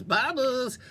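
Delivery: normal talking voice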